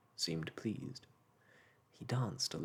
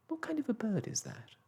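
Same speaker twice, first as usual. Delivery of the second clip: put-on high, eager little boy; curious boy; 3 dB louder than usual. A narrator's reading. Michael, unable to wait, asks Mary Poppins, high and eager. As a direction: blurt it out like an excited child.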